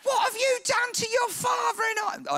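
hammed-up worried mama; fretting mother. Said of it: "I don't know if she spoke like that".

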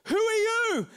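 high, sharp who are you; demanding. Retold or imitated. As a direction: challenge the stranger. Friend challenging driver.

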